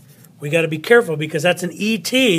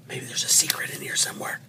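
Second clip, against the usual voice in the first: quick hushed whisper; secretive whisper; 10 dB quieter, faster than usual. Pretend. Teacher musing that a secret hides in here, secretive whisper.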